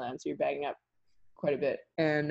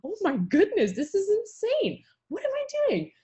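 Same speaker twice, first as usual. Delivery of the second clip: crying exasperation. Retold or imitated. Stuck in muskeg in the rain, to herself: high teary wail. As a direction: voice climbs much higher into a teary whine. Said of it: "I'm just in my head, I'm like".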